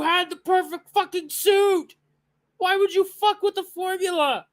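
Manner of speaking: high, loud outraged yelling at the screen; betrayed fan screaming at the studio; furious shouting. Real, not acted. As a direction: rant in real fury, pitch way up. Fan furious at costume change.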